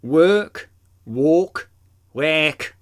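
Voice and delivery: "work" and "walk" with shifting vowels; dialect vowels. Shown as a demonstration. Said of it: "Vowel sounds change in dialects".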